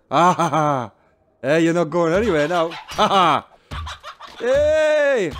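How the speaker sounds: laughing triumphantly; gloating laugh. Real, not acted. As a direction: cackle at the caught chicken. Caught the chicken, gloating.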